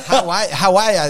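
loud, high Australian greeting; best Australian accent. Retold or imitated. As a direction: shout it in a hammed-up Aussie accent. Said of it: "do your best Australian accent".

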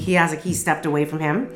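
ordinary delivery

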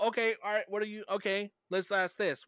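ordinary talk, nothing marked

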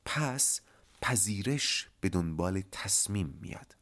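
slow and weighty, key words set apart; measured, emphatic delivery of a principle; slow, deliberate maxim. Real, not acted. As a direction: spell out the key sentence slowly. Dictating a key maxim after "listen.

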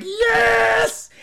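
high, loud cheer; high shouted cheer; triumphant yes. Retold or imitated. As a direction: yell yes in gleeful triumph. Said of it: "I was like".

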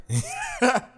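high squeal of laughter. Real, not acted. Laughing at his own teen story, a high squeal. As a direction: burst into a high-pitched laugh.

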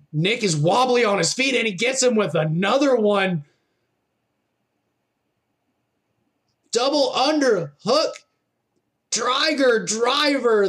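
steady live play-by-play calling; wrestling commentator. Real, not acted. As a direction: call each move as it lands.

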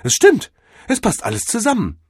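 high, loud amazement; ecstatic realisation. A narrator's reading. Cordell sees everything connect, ecstatic. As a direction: cry out that it all fits. Cordell's revelation falls into place.

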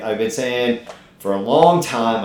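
ordinary talk, nothing marked

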